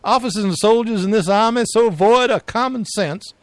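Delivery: loud, high Southern general's rebuke; Southern accent. Retold or imitated. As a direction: scold the troops in a Southern accent. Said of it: "maybe they all talk kinda like this".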